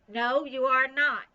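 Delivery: loud, slow, unimpressed no; flat refusal. A narrator's reading. Nancy refuses Bean's ghost threat, loud and slow. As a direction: shut the silly ghost down firmly.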